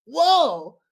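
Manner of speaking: sudden high loud whoa; startled whoa. Real, not acted. Streamer startled by a sound in the morgue. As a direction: jump at a noise.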